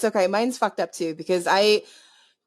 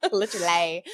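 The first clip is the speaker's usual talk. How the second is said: learner's quieter try at Essex "Literally"; copied Essex accent; 4 semitones lower, 5 dB quieter than usual. Retold or imitated. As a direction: imitate Essex, leaning on the LY of "Literally". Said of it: "that's Essex for you".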